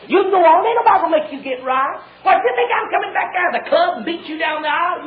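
urgent revival exhortation; full-force preaching. Real, not acted. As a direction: challenge the congregation forcefully.

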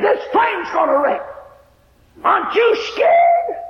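high, loud and slow panicked shout; terrified shout. Retold or imitated. Old woman shouting her fear at the girl. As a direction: shout it in terror. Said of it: "She got frantic and hysterical".

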